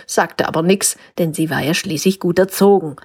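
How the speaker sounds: ordinary delivery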